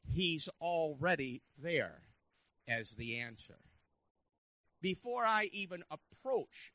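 loud, emphatic sermon delivery with drawn-out key words; forceful preaching. Real, not acted. Preacher tells congregation God is already there, forceful proclamation. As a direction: drive each phrase home, loud and deliberate.

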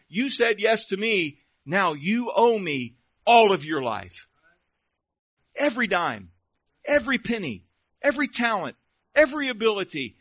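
loud, high, emphatic preacher voicing Jesus; full-force preaching. Jesus demanding a believer's whole life, preached forcefully.

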